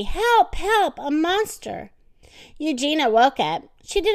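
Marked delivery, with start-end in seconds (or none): 0.0-1.9 high, alarmed storybook cry; shouted plea for help. A narrator's reading. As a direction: cry out for help, slowing at the end. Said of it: "shouted Baby".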